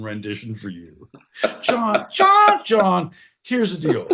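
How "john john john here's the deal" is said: very high, loud call, then slower; urgent calling out. Real, not acted. Host calls out to John to lay down the deal. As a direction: call him over, urgently.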